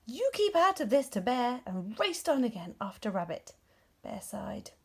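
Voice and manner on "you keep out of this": louder, higher threatening shout; wolf shouting a warning. A narrator's reading. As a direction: bark it up the hill at Bear.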